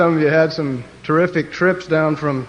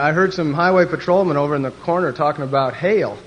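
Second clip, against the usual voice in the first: normal talking voice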